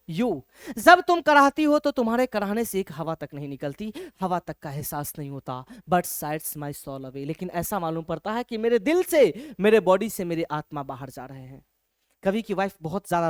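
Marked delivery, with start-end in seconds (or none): 5.9-7.2 very slow, soft recital of the verse; slow poem reading. Shown as a demonstration. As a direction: let the line drift out slowly. Teacher recites poem line.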